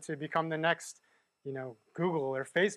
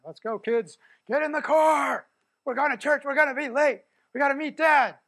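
hurried parent; 9 semitones higher, 9 dB louder than usual. Pretend. Parent rushing kids off to church, calling out loudly. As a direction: call the kids out to the car, loudly.

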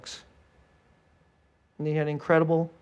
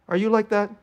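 normal talking voice

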